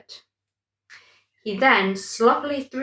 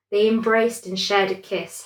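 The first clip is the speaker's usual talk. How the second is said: ordinary delivery, nothing marked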